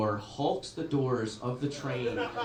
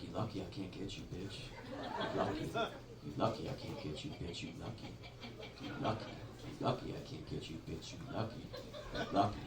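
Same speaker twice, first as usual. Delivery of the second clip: muttered threat; 5 semitones lower, 5 dB quieter than usual. Retold or imitated. Comic voicing a woman threatening another from afar, repeated mutter. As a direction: keep hissing the threat over and over, half-laughing. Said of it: "kept threatening her from afar".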